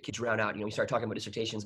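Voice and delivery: normal talking voice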